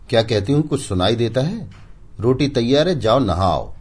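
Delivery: high, loud, insistent wife calling again. Acted. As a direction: call him a second time, raising her voice. Wife repeating call, ignored.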